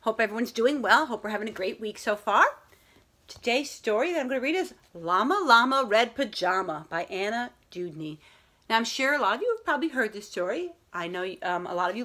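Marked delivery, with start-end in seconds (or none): none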